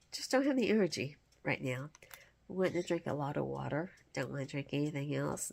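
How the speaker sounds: ordinary talk, nothing marked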